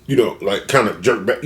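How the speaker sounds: ordinary talk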